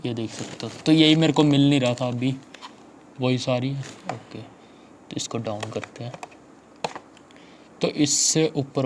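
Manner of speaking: muffled behind chin bar; talking through helmet; speaking inside helmet. Real, not acted. Seen 0: Helmet worn throughout; chin bar covers mouth, visor raised.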